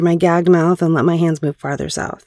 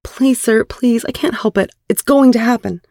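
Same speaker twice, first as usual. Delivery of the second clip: higher-pitched, desperate pleading; begging; 7 semitones higher than usual. Acted. Woman told to beg by her partner, high begging. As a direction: plead as if barely holding on. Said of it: "beg me".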